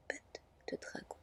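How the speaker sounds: usual speaking voice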